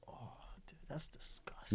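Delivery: whispered in disgust; hushed, grossed-out whisper. Real, not acted. Reacting to squishy gum.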